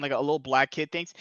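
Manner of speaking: ordinary talk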